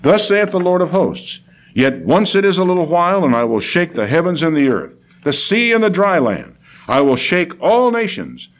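formal King James recitation; scripture proclamation. Acted. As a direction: proclaim it as the Lord's own word. Reads Haggai aloud.

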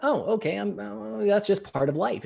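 a hint of an unbothered farmer; lightly voiced farmer. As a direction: shrug it off as a farmer would. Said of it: "and he went".

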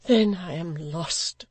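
mild despair, drawn out; despairing widow. A narrator's reading. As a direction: let it out as a lament. Widow despairs at his nearness.

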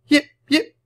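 very high, loud little dog yelps; puppy yips. A narrator's reading. Scruffy puppy answers as the ball flies, yipping. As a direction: yip like a small excited puppy.

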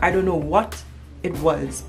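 ordinary talk

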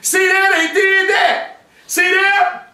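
high, shouted gloating to God; Satan shouting his case against people to Allah, gloating; taunting devil. Acted. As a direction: crow over the sinners like Satan bragging. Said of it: "to prove to Allah".

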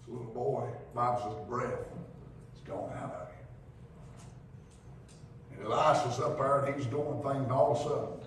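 hushed prayer retold by the preacher; hushed sermon. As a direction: bring it down to a hush. Hushed storytelling climax.

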